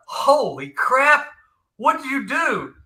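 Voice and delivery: drawn-out, high-pitched astonishment; hammed-up "holy crap" awe; retold amazement. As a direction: stretch it out, stunned by what you see. Retold amazement at friend's inks.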